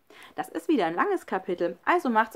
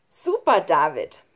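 loud praise; 3 semitones lower, 10 dB louder than usual. A narrator's reading. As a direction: cheer your friend's success. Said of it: "lobte Schlunz". (laut lobender Ausruf)

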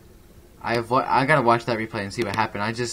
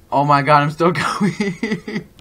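laughing excitedly; 6 semitones higher, 8 dB louder, much slower than usual. Real, not acted. Ragdoll survives harpoon hit.

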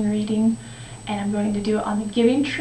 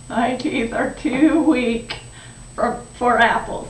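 put-on weak old man. The aged boy refuses apples, weak and old. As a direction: drag the words out like a feeble old man.

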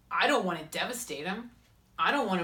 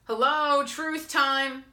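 high, loud, urgent wake-up call; higher self scolding; 8 semitones higher, 5 dB louder, slower than usual. Pretend. Higher self calling Christine out, high and loud. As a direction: snap her out of it, loud and high. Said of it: "your higher self saying".

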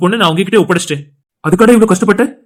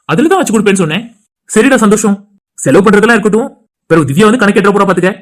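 ordinary talk

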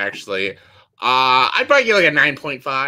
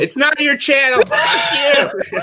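high, loud comic outburst; mock angry yell; 10 semitones higher, 4 dB louder, slower than usual. Host jokingly claims the guest's channel, mock angry yell. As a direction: yell it in playful outrage.

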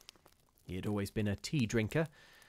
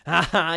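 loud, higher cackling ha ha; the charwoman mocking the scholar with a laugh; harsh old woman's laugh; 7 semitones higher, 12 dB louder than usual. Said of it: "The old woman laughed harshly".

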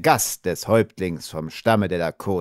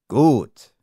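praising; 4 semitones higher, 6 dB louder than usual. A narrator's reading. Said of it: "sagte er nochmals lobend".

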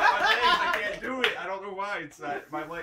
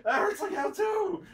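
high pained whine; 11 semitones higher, slower than usual. Real, not acted. Complaining of the shock pain.

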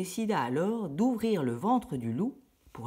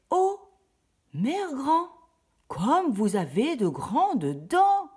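much higher, slow amazed voice; wondering little girl; 9 semitones higher, 6 dB louder, much slower than usual. Acted. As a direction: marvel at grandma's big eyes. La fillette s'étonne. (étonnement lent et aigu de la fillette)

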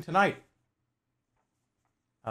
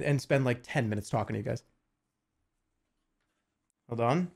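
ordinary delivery

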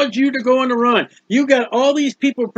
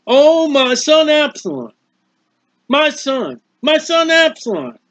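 lament; 5 semitones higher, slower than usual. Retold or imitated. David lamenting his son.

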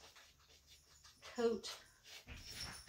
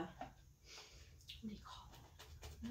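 whispering; 15 dB quieter, much slower than usual. Real, not acted. Searching for the word.

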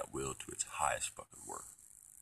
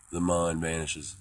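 slow, ringing, raised voice; louder, stretched-out declaration; loud proclamation; 3 semitones higher, 11 dB louder, slower than usual. Pretend. Repeats phrase as loud proclamation.

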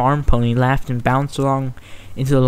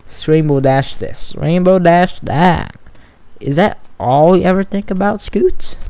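arguing; 5 semitones higher than usual. Acted. Sweetie arguing with Scootaloo about Rainbow Dash, exasperated. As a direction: throw the complaint at her friend. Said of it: "Sweetie argued".

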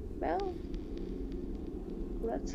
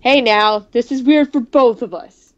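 loud, startled greeting to the double; 10 dB louder than usual. Acted. Meeting his double, a loud startled call. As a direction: blurt it out, caught off guard.

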